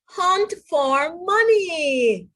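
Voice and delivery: drawn-out rallying cry; eager declaration. Acted. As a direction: announce the big plan with gusto. Missus Pig rallies the family.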